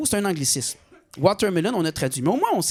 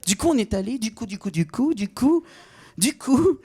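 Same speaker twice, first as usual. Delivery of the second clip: mocking French tic; 4 semitones higher than usual. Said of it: "Ils disent toujours «du coup»".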